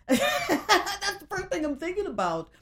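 high-pitched, laughing admission; amused, voice lifted by laughter. Real, not acted. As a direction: burst out laughing, then talk through it. Laughs at her own thought.

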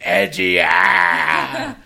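loud edgy rock shout; rock yell. Retold or imitated. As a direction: belt it like a rocker. Mimics edgy rock part.

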